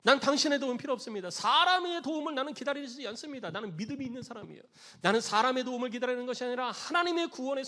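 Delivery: loud, high-pitched insistence; acted firm, loud dismissal; adamant refusal. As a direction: wave the rescuers away with stubborn conviction. Drowning believer refusing the rescuers.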